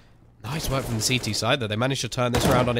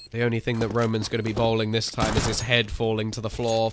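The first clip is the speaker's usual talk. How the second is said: normal talking voice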